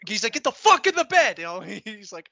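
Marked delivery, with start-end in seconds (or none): 0.3-1.4 high, loud shouted order; imitating a drill sergeant screaming; drill sergeant yell. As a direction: yell it at the sleepy kid.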